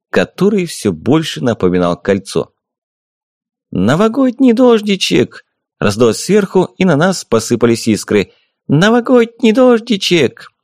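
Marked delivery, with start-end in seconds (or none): 3.7-5.4 high, slow sing-song fairy call; fairy cry. A narrator's reading. The fairy showers sparks on the group, high sing-song call. 8.7-10.5 high, slow sing-song fairy call; fairy cry. A narrator's reading. The fairy showers sparks on the group, high sing-song call.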